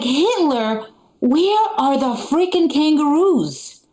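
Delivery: put-on a seven-year-old demanding kangaroos; confused kid. Child shocked at Hitler's house, slow baffled outrage. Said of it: "I was like".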